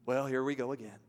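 ordinary talk, nothing marked

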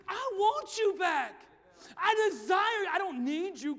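God calling out. Acted. Preacher proclaiming to the youth God's call back. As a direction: proclaim God's desire loudly. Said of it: "God says".

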